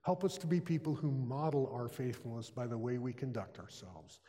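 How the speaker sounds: soft, unhurried prayer voice, fading low; closing prayer. Acted. Pastor closing the chapel sermon in prayer, hushed and reverent. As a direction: pray gently over the congregation.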